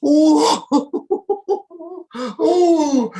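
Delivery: high, loud ooh with laughter, wiping tears; laughing ooh. Shown as a demonstration. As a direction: laugh until you cry, oohing high. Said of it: "They do this".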